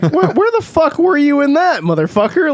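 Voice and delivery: high, indignant angry yell; angry confrontation. Acted. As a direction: chew him out for never showing. Angrily confronting absent grandpa.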